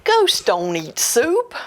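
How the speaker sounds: ordinary delivery, nothing marked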